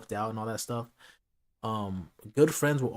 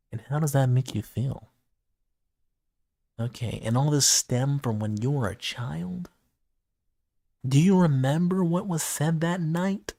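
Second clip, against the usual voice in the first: mock therapist; 2 semitones higher, 5 dB louder than usual. Mock therapist questioning friend.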